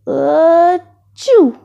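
loud mock sneeze. Dad allergic to the kittens, big comic sneeze.

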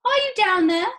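high, loud, drawn calling; anxious mother calling out; shouted call. A narrator's reading. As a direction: holler down a hole to someone. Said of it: "shouted Missus Salt".

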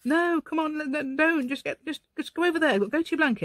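high-pitched pleading command; exasperated, high-voiced dog scolding; telling off dogs. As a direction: re-enact shooing pestering dogs away. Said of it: "And I'm there going".

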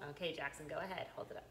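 ordinary delivery

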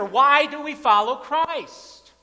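ordinary delivery

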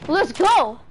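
loud, high triumphant cheer; excited shout. Real, not acted. Gamer cheering own play, excited shout. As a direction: shout it out in celebration.

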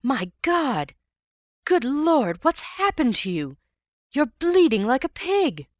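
high, loud cries of horror; horrified high-pitched exclamations. A narrator's reading. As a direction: recoil in shock at the bloody man. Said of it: "Ewbank's voice in horror".

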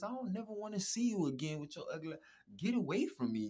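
ordinary talk, nothing marked

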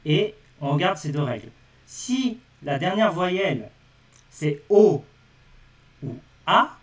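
teacher stressing key vowels o and a; emphatic rule repetition. Shown as a demonstration. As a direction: hammer the rule home for students. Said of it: "j'ai fait exprès de répéter".

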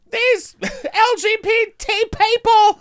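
very high, drawn-out mocking voice; mock outraged public. Podcaster mimicking everyone's complaint, high mock outrage. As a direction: squeal it slowly as a hammed-up complainer. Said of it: "And everyone's like".

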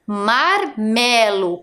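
slow syllable-by-syllable "Marmelo"; reading out slowly. Shown as a demonstration. Teacher reading a word to young pupils, slow reading out. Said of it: "está escrito o quê?".

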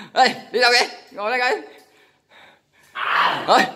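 high, loud call across the room. Real, not acted. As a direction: call him back loudly. Calling a friend back.

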